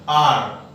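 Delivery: "आर" as a long aa with the r dropped; British R. Shown as a demonstration. Teacher shows British style, silent R. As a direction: say "आर" without sounding the final r. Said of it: "इस तरीके से pronounce करते हैं".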